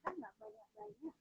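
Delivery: very faint, far from the mic; barely audible. Real, not acted. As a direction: voice drifting away from the mic. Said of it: "suaranya terlalu kecil".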